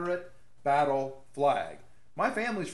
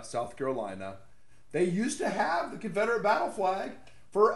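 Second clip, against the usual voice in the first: normal talking voice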